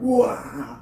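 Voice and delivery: short high yelp while being tased; a quick pained cry of a man getting tased; mock yell. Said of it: "now I'm kinda yelling".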